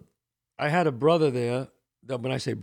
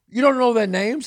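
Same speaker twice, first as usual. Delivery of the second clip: higher and louder, stunned disbelief; incredulous wife; 9 semitones higher, 8 dB louder than usual. Retold or imitated. Wife asking about the groomsmen, incredulous. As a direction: she can't believe he doesn't know.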